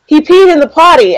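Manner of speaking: high excited praise; potty training praise. Retold or imitated. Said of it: "And I was like".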